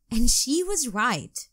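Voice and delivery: ordinary delivery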